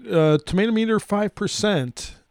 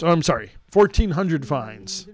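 ordinary delivery

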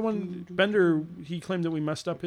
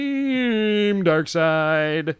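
slow build, then an endlessly stretched name; hammed-up milestone proclamation; drawn-out announcer; 5 semitones higher, 5 dB louder, much slower than usual. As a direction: announce it with one very long vowel.

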